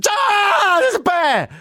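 high screamed alarm at a bear; panicked scream. Acted. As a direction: shriek it out in sheer panic. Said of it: "it's like this".

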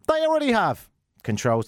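high, exasperated outburst; exasperated retort. Acted. Host rebutting Apple's feature list, exasperated retort. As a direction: pitch it up in exasperation.

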